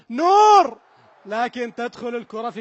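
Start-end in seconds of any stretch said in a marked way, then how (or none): 0.1-0.8 high, loud pleading call; a desperate shout of نور; anguished cry of the keeper's name. Real, not acted. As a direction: cry out his name as the shot comes. Keeper faces penalty, cry out.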